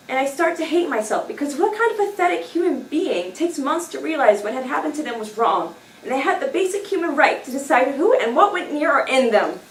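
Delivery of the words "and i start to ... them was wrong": plain reading voice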